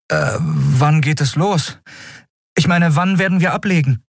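put-on unsure young cabin boy; hesitant new recruit. A narrator's reading. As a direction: ask the captain tentatively, pitched high. New boy hesitantly asks captain.

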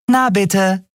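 a cheeky grin in the voice; grinning Nelen. Acted. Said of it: "sagte Nelen grinsend".

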